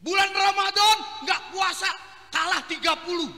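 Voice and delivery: loud, high mocking call-out; preacher's mock outrage; mock scolding shout. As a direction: scold the lazy rich man loudly. Preacher mocks the rich man.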